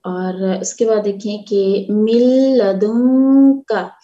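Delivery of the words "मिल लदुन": मिल लदुन slow and loud, the nun merged into the lam. Shown as a demonstration. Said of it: "नून साकिन के बाद लाम है". (मिल लदुन धीरे और ज़ोर से)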